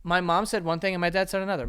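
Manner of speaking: ordinary delivery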